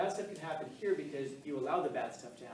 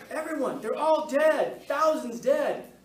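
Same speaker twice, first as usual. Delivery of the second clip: much higher, louder, very slow hammed-up wail; panicked shout; 11 semitones higher, 12 dB louder, slower than usual. Teacher acting a frantic student reporting to the principal, mock shout. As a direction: wail it out like the sky is falling.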